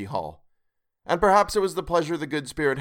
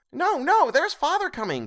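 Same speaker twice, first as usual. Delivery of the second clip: put-on much higher, louder urgent kids; excited children; 3 dB louder than usual. A narrator's reading. Young Cratchits warning Martha, much higher excited cry. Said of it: "cried the two young Cratchits".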